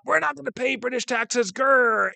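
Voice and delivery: strained mock yell ending in a growl; mock protest shout. Teacher imitating colonists refusing British taxes, mock protest shout. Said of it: "and they shout".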